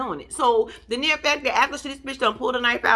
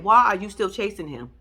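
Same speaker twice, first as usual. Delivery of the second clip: ordinary talk, nothing marked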